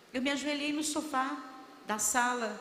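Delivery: ordinary talk, nothing marked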